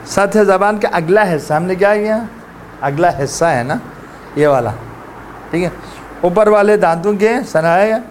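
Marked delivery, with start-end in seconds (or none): none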